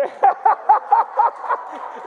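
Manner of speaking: high, loud rapid cackle; long bursting cackle; real laugh. Real, not acted. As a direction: crack up uncontrollably. Breaks up at himself.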